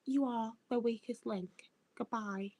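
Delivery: slow, clipped quiz-host dismissal; Anne Robinson. Retold or imitated. YouTuber mimicking a quiz host who lost her Scouse, slow impression. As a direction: imitate a stern elocuted host, soft and measured. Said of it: "she's all like".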